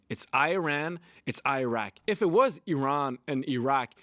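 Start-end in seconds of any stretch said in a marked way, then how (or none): 0.1-1.0 Iran said as "eye-RAN"; Iran with a long "eye" at the front; English pronunciation. Shown as a demonstration. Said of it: "That's how we say it". 1.2-1.9 Iraq said as "eye-RACK"; Iraq with a long "eye" at the front; English pronunciation. Shown as a demonstration. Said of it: "That's how we say it". 2.7-3.9 "ee-RAHN and ee-RAHK" for Iran and Iraq; Iran and Iraq each starting on "ee"; pronounced with E. Shown as a demonstration. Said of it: "it would be an E".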